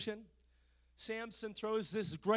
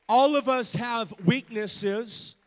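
loud, shouted preaching with rhythmic uh; full-force preaching; 2 semitones higher, 12 dB louder than usual. Acted. Preacher warning his congregation against the devil, shouting. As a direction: hammer the warning home to the congregation.

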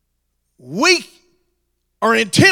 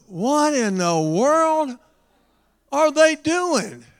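slow, drawn-out baffled question, rising higher; mock bewilderment; 6 semitones higher, slower than usual. Crowd watching the roof break, drawn-out mock bewilderment. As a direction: stretch it out like a baffled onlooker.

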